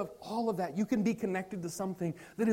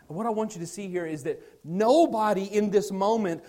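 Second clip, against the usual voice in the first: preaching at full force; 3 semitones higher, 5 dB louder than usual. Acted. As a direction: drive the point home to the church. Preaching climax, louder and higher.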